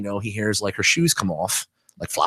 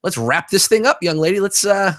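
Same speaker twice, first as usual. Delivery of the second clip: bold client; 8 semitones higher than usual. Pretend. Imagined bold proposition to masseuse.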